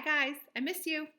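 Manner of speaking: ordinary talk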